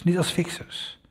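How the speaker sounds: quiet echo of "niet als fixers" with "niet" leaned on; soft echo. Acted. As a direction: let "niet als fixers" fall away softly. Repeated phrase, echoed softly.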